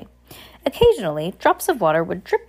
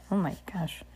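real whispered aside, very soft; 11 dB quieter than usual. Real, not acted. Reader aside on the rambling captain, whispered. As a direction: whisper it under your breath.

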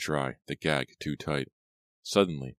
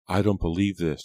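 louder, raised disbelief; exclaiming; 4 semitones higher, 6 dB louder than usual. A narrator's reading. Girl learns captive is a Dalby, exclaiming. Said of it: "the girl exclaimed".

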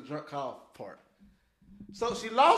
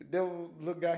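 ordinary talk

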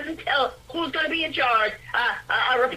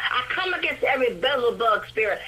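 commanding prayer; 2 semitones lower, faster than usual. Acted. Rebuking spirits in prayer.

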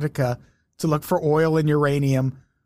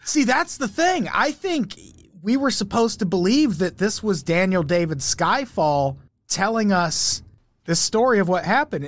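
ordinary talk, nothing marked

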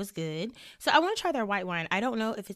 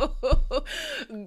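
excited squeal; 8 semitones higher, 7 dB louder than usual. Real, not acted. Excited squeal before topic.

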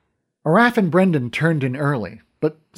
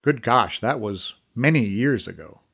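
plain reading voice

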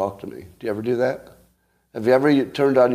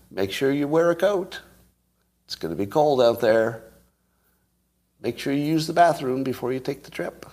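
mom voice; 5 semitones higher than usual. Pretend. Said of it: "throw in some momisms".

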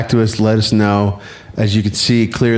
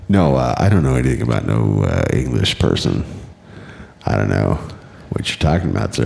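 low evasive denial; 10 semitones lower than usual. Pretend. Evasive mock denial, voice dropped.

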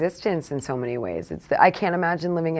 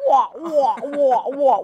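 muffled wah wah talk; 9 semitones higher, 8 dB louder, much slower than usual. Pretend. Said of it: "radio impression".

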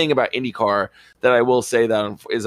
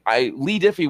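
normal talking voice

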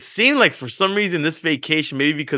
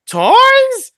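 put-on much higher and louder, a child's excited cry; a little girl spotting the hidden presents; surprised child; 8 dB louder than usual. Daughter discovers hidden gifts.

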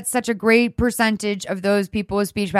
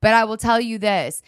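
normal talking voice

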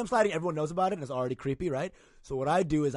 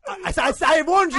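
loud warning; 12 semitones higher, 9 dB louder than usual. Real, not acted. Teasing reply after the bad text.

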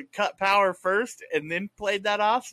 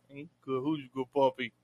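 talking to a dog; 6 semitones lower, 8 dB quieter than usual. Real, not acted. Greeting the dog Cannon.

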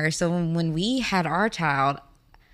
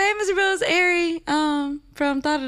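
higher, bright-eyed phone hello; higher, cheerful self on the phone; sweet phone greeting; 10 semitones higher than usual. Retold or imitated. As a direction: greet him like a friendly caller. Retells her phone greeting.

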